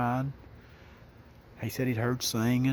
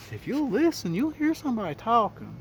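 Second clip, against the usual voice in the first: much higher, louder, urging him to listen; insistent; 11 semitones higher, 4 dB louder than usual. Retold or imitated. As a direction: pitch it up high, pressing the guard to hear it.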